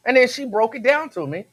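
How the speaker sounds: ordinary delivery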